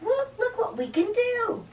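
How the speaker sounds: high little mouse voice; high-pitched, coaxing storybook mouse; reassuring mouse. Acted. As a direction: comfort a sad friend in a high squeak. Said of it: "so the mouse is telling him".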